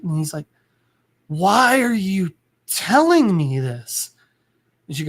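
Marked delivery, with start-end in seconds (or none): 1.3-4.1 slow, drawn-out patient straining for words; labored, swooping plea through an oxygen mask; struggling to speak. Retold or imitated. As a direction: drag out each word with great effort. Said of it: "struggling to speak".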